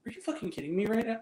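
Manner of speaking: retold exasperated muttering; muttered out loud. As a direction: mutter it fast under your breath, angry. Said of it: "I muttered it out loud".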